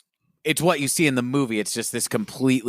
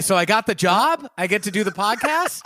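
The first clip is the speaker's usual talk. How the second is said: higher, hopeful questions in pretend delight; mock excitement; 8 semitones higher than usual. Host playing auditioner to co-host who praised him, mock excitement. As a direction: play the thrilled newly hired auditioner.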